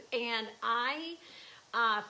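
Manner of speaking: ordinary talk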